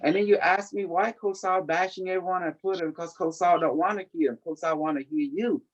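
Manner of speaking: normal talking voice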